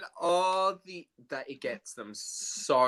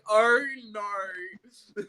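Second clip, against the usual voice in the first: laughing mock dismay; 8 semitones higher, 4 dB louder than usual. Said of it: "and then I'm like".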